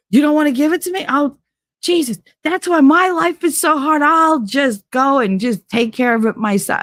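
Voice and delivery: high, whining self-pity; mock whiny brother. Mocking the golden boy guilting his mother, whiny. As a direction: whine like a spoiled son.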